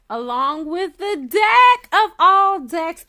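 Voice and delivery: gleeful slow shout; excited shout. Real, not acted. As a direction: announce the deck of all decks with a thrill. Revealing the longed-for deck.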